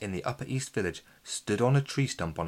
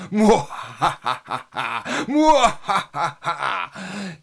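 slow sinister villain laugh; evil cackle; 7 semitones higher, 11 dB louder, much slower than usual. Acted. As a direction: cackle like a cartoon baddie. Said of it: "let out a sinister cackle".

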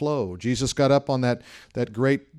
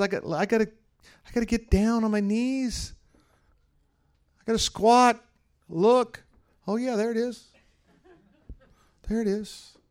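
much higher, amused God squinting down at a tiny tower; playful God voice; 12 semitones higher than usual. Pretend. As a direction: play God stooping down to find it, pitched way up. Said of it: "God says".

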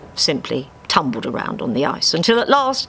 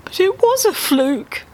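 very high wailing whine; wailed; 14 semitones higher than usual. Acted. Dave despairing about his win, high wail. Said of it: "Dave wailed".

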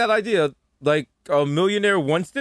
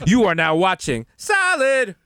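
slow, grand promo voice; announcer read; 4 semitones higher, slower than usual. Pretend. Mock TV promo to the listeners. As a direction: sell it like a network promo.

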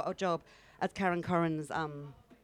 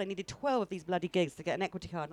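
usual speaking voice

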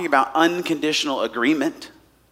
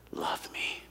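whispered softly, barely voiced; 17 dB quieter than usual. A wife too afraid to ask aloud, whispered. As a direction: breathe it as a secret plea. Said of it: "than it is to say".

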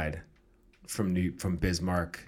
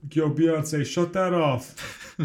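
higher, louder mock complaint; 7 semitones higher, 5 dB louder than usual. Host plays an ex-fan talking to his wife, mock fed up. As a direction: call out in a put-on fed-up voice. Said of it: "and he's out".

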